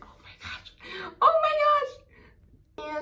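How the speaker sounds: whispered oh my gosh building to a slow high squeal; breathless whisper rising to a loud high cry; overwhelmed squeal. Retold or imitated. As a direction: gasp it, then squeal it out slowly. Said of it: "And I was like".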